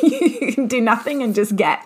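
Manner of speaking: laughing through the line. Real, not acted. Telling viewers you can get by doing nothing, laughing. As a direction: chuckle it out, amused. Laughs at her own bold claim.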